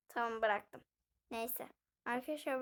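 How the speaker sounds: ordinary delivery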